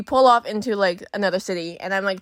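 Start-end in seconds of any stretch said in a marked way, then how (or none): none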